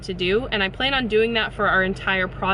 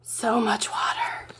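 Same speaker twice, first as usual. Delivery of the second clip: whispering; slower than usual. Real, not acted. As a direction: breathe it out in wonder. Awed at flooded yard.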